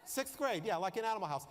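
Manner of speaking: ordinary talk, nothing marked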